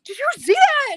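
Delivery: put-on excited kid's shout. Son surfacing while snorkelling, shouting to mom in amazement. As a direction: yell it like a boy who just saw something huge. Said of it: "He goes".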